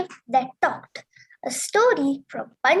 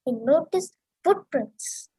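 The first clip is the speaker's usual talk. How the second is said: plain reading voice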